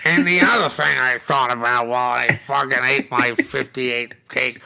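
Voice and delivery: mock English Roger Ebert. Host mocking Roger Ebert's commentary in a mock English accent. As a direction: sneer it like a stuffy English film critic. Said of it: "I made him English".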